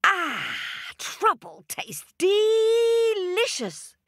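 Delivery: high, slow, savouring monkey voice. A narrator's reading. Little Monkey savouring the honey in a high, slow voice. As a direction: draw out the delight of the taste.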